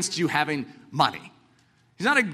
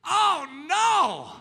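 dismayed; 9 semitones higher than usual. Retold or imitated. Mocking world's reaction.

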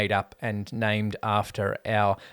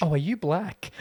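bad South African accent; 6 semitones higher than usual. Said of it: "Bad South African accent too".

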